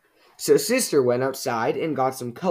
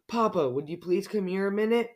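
higher-pitched worried call; raised pitch, understated distress; upset mother; 7 semitones higher than usual. Acted. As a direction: call out for help, sounding upset. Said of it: "cried Mama, sounding upset".

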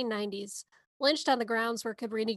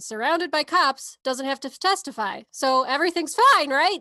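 louder, higher mock-cheerful sarcasm; sarcastic; 4 semitones higher, 6 dB louder than usual. Real, not acted. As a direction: say it like everything's fine when it isn't. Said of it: "sarcasm for the record".

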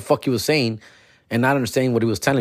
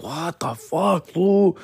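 drawn-out, higher-pitched disbelief; baffled exasperation; 6 semitones higher, much slower than usual. Retold or imitated. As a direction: stretch it out, utterly lost. Reacting to baffling stream of words.

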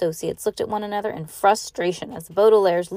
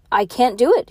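higher, louder public declaration; raised voice, lifting in pitch; loud announcement; 5 semitones higher, 7 dB louder than usual. A narrator's reading. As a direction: announce it to a whole crowd. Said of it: "he announced".

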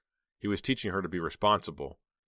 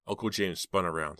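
usual speaking voice

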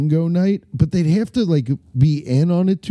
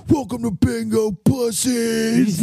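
drawn-out, high monster truck shout; monster truck announcer; 8 semitones higher, slower than usual. Shown as a demonstration. Bingo caller greeting the bar crowd, monster truck announcer scream. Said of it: "the monster truck voice".

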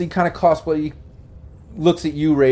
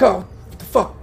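higher-pitched jolt awake, lightly acted; groggy prisoner; 7 semitones higher than usual. Game master voices a prisoner shaking out of unconsciousness, groggy. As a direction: play a captive shaken back to consciousness. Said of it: "he pretty much shakes out".

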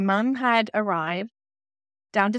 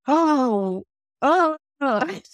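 mock realization; 5 semitones higher, 3 dB louder, much slower than usual. Sudden realization at the trial.

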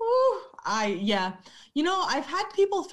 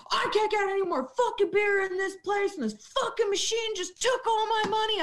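much higher whiny mock outburst; mock drunk rant; 9 semitones higher than usual. Bartender imitating a regular who lost at the VLT, whiny. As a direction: whine it like a broke drunk.

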